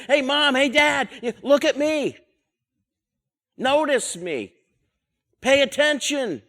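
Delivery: put-on high, eager kid calling out to mom and dad; a kid on the monkey bars begging to be watched; child calling to parents. Kid on the swing calls to mom and dad, high and eager.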